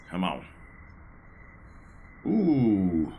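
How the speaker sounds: ordinary delivery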